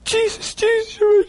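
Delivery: very high, monotone praise spilling into babble; rapturous praise. Retold or imitated. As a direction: imitate breathless-with-praise worship on one high note. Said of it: "Praise filled her lips, praising Jesus".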